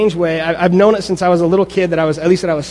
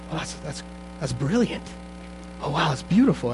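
hushed awe; 10 dB quieter than usual. Retold or imitated. Retelling being lost in music.

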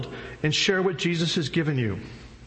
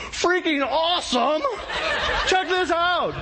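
much higher, louder, laughing yell; hothead's scream; 3 dB louder, slower than usual. Acted. Hothead Peter screams to the crowd about his bread, laughing. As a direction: shout it out like an overjoyed hothead. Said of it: "Peter's the hothead. He's screaming".

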